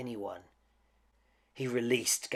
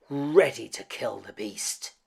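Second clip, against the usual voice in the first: plain reading voice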